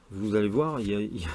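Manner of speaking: normal talking voice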